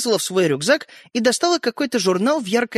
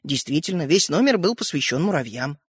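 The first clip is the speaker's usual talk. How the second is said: usual speaking voice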